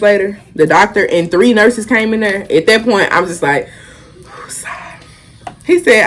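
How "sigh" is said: long unvoiced sigh; weary sigh. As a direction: let the breath out, fed up. Spoken sigh when the doctor returns.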